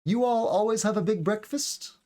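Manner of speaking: pitched high, "breakfast" tipping upward at the end; upward question intonation. Shown as a demonstration. Teacher demonstrates intonation to students, upward question tone. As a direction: turn it into a question with a lift on "breakfast". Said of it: "kind of has an upward tone".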